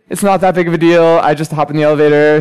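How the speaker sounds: ordinary delivery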